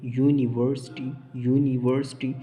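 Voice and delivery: "यूनिवर्सिटी" loud and careful, opening on "yoo"; teacher sounds out "यूनिवर्सिटी" starting with a y; y-sound demo. Shown as a demonstration. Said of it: "ये वाई का आवाज़ दे रहा है".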